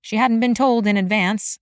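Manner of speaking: plain reading voice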